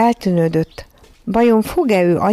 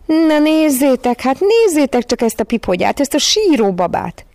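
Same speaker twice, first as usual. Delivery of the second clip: jeering cry; 8 semitones higher, 3 dB louder than usual. A narrator's reading. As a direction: crow it loudly, stretching the first words. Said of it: "kiáltotta".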